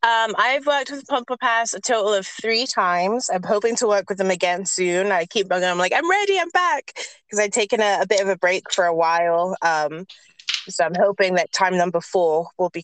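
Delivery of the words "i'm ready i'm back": high, keen mock plea; imitating an eager reminder; eager announcement. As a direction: pipe up eagerly, much higher. Acted eager plea to studio.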